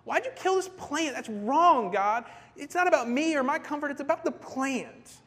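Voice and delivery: high, hammed-up whiny protest; angry complaint. Preacher playing Jonah angry at the Lord, indignant. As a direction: protest to God like a wronged child. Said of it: "Jonah is just, just angry at the Lord".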